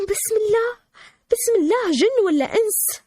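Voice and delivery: high, startled family cry; retold frightened screaming. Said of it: "صاروا يصرخون ويبكون ويقولون".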